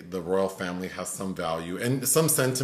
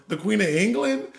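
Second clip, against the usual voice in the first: incredulous friend; 10 semitones higher than usual. Retold or imitated. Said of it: "her friend off camera goes".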